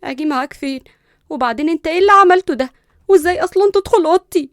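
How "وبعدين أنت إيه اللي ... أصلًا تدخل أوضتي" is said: loud, high angry demand; fired-up confrontation, pitched up; indignant scolding. Acted. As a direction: raise your voice in outrage. Woken woman scolds intruder.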